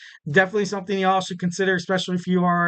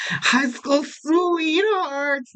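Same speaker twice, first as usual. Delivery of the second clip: mock dreamy swoon; 9 semitones higher, much slower than usual. Mock swooning over the couple.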